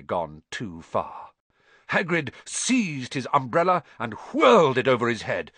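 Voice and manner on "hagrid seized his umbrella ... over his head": ordinary delivery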